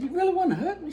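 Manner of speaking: high-pitched astonished question; incredulous delight. Retold or imitated. Teller asked for a hug by the boss, incredulous delight. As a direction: ask it high, hardly believing it. Retold disbelief at hug request.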